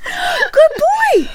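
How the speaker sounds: high, loud praise for a dog; talking to a dog. Real, not acted.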